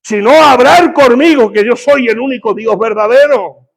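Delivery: loud, high preacher's proclamation; voice of God. Acted. God warning the people against foreign gods, commanding shout. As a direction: thunder it out as a divine command. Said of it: "y la voz de Dios era".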